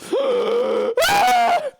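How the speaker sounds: sharp, high, loud shriek of shock; shrieking gasp. Real, not acted. Streamer losing progress, shrieking gasp. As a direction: gasp as the climb fails. Falling off the climb.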